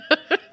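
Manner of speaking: high burst of laughter. Real, not acted. Mom laughing at her son's joke.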